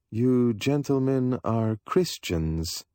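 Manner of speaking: slow, faintly scornful remark; a touch of disdain, measured; faint contempt. A narrator's reading. Stranger sizing up the rug dealers, faintly contemptuous. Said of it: "faintly tinged with contempt".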